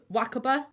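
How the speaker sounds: "Wakaba" said WACK-uh-buh; short, stressed first syllable on "Wakaba"; corrected pronunciation. Shown as a demonstration. Vlogger repeating the correction, "Wakaba" stressed at the front. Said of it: "it's actually pronounced Wakaba".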